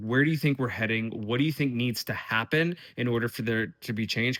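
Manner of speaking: usual speaking voice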